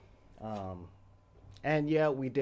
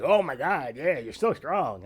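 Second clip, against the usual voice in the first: mock admiration; 4 semitones higher, 6 dB louder than usual. Kids praising a strong classmate.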